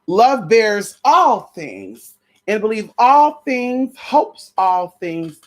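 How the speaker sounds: very slow, stretched sermon cadence; preacher building on the verse, very slow; slow preaching. Acted. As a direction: draw out every phrase.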